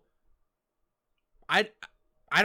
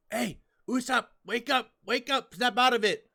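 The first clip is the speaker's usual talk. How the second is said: higher, urgent mock Chopper shaking a friend; urgent wake-up call; 7 semitones higher than usual. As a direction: plead with him to wake up, lightly voiced. Chopper shaking dazed Usopp awake.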